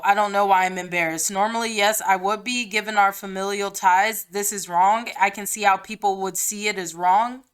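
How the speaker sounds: ordinary talk, nothing marked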